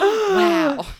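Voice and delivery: loud, high-pitched wow; mock amazement. Audience member fooled by the trick, mock amazement. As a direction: let out a big, awestruck wow.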